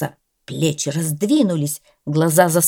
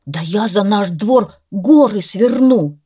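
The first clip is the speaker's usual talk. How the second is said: eager vow; 3 semitones higher, 7 dB louder than usual. Acted. Dog vows eagerly.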